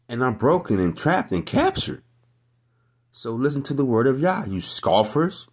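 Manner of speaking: high, loud shouted rebuke; preacher at full roar; fiery preaching. Real, not acted. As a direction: blast the scoffers from the pulpit. Preacher climaxes, rebukes scoffers.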